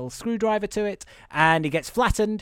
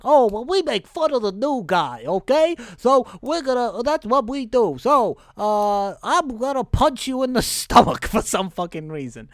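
high, loud put-on bully voice; hammed-up, raised-pitch taunting; Terry Crews and David Koechner; 6 semitones higher, 4 dB louder than usual. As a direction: play blustering nerds picking on the newcomer. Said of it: "Terry Crews and David Koechner are like".

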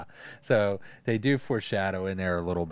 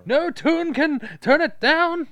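much higher, louder and slow; villain voice; 9 dB louder, slower than usual. Retold or imitated. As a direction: stretch it out in a shrieking cartoon sing-song. Quoting villain's trick line.

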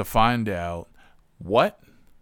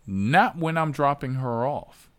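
ordinary delivery

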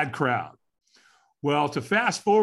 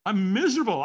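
I'm miserable said higher and exasperated; exasperated complaint; 7 semitones higher, faster than usual. Retold or imitated. To the early caller, higher exasperated complaint. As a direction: whine it back at the caller. Grumpy reply to early call.

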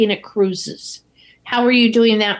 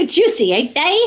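higher, amused Cockney impression; mock Cockney; 5 semitones higher than usual. Joining co-host's impression of the letter seller, mock Cockney. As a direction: tease with a put-on Cockney lilt. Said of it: "It was a Cockney accent".

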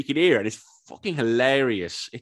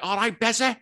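much higher Scouse dung beetle voice; cheeky Scouse beetle, pitched up high; Scouse beetle accent; 11 semitones higher than usual. Retold or imitated. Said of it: "It's Scouse ding-, dung beetles".

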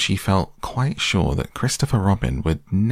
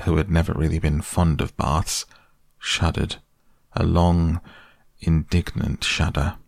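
usual speaking voice

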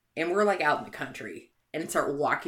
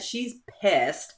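ordinary talk

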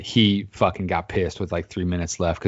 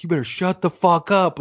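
higher-pitched mock threat; retold angry warning; 10 semitones higher than usual. Rival fans turning round on hecklers, mock angry warning. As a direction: imitate a riled fan warning hecklers.

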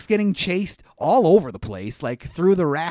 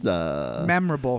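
deadpan; 10 semitones lower, slower than usual. Acted. Deep slow deadpan.

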